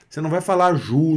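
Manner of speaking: ju said with an oo sound; ju, oo vowel; wrong vowel. Shown as a demonstration. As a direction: show the wrong ju. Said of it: "É je".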